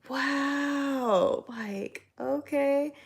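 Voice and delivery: high, stretched-out mock-offended reaction; taken aback. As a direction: act out stunned, grudging acceptance. Retold reaction to being called annoying.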